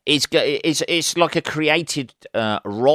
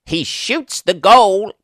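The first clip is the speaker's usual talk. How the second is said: much higher, slow mock American commentator; 9 semitones higher, 5 dB louder, slower than usual. Brit mocking American football commentary, American accent. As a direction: drag out the call like a US announcer. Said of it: "commentators, uh, on football".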